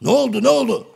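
high, loud, urgent question; alarmed asking. Acted. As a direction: blurt it out in comic alarm. Alarmed reaction to Hacivat's cry.

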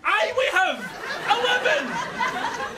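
high, indignant cry, the last word stretched out; exasperated retort. Acted. Trapped man telling the lift he has selected a floor, exasperated. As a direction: answer back furiously, dragging out the floor.

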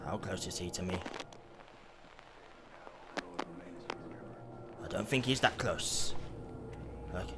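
whispering while hiding. Real, not acted. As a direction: breathe it out so he won't hear. Hiding from monster.

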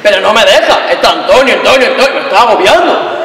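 loud, insistent complaint; agitated protest. Acted. Woman complaining that Antonio smothers her, agitated. Acted quarrel, feeling smothered.

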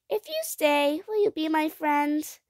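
put-on high, childlike asking voice; high-pitched earnest question; hopeful child. A narrator's reading. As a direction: ask like a hopeful little girl. Child asks monster gently.

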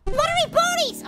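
loud, high-pitched outburst; high, exasperated yell; comic curse. Real, not acted. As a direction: shriek a silly swear at losing. Nonsense curse after failing.

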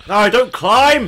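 urgent yell. Real, not acted. Yelling at teammate mid-fight.

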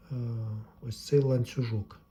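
ordinary talk

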